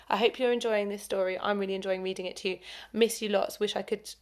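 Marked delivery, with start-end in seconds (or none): none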